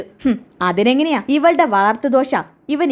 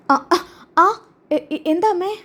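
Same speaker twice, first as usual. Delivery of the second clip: higher, stammering nervous reply to mother; 6 semitones higher, slower than usual. Acted. Chandra called by her mother-in-law, answers in a higher nervous stammer. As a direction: stutter out of fear, pitched up.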